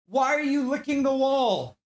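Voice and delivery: high, loud, incredulous shout; yelling at dog. Real, not acted.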